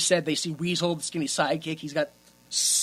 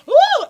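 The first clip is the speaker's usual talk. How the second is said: one high, loud fake moan; imitated sex moan, pitched way up; mock moaning "ooh"; 9 dB louder than usual. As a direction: whoop out a badly faked moan. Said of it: "and she was going".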